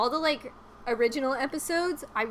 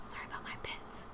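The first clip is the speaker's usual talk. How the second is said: hushed whisper; 14 dB quieter than usual. Real, not acted. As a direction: whisper it close to the mic. Unvoiced whisper.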